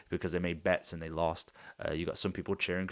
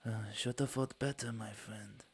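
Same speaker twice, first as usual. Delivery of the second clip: higher, softer mocking consolation; gloating taunt; 4 semitones higher, 5 dB quieter than usual. Halfling taunting the maimed loser, softer and higher. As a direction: pity them with a sneer. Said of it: "he then turns towards you and he goes".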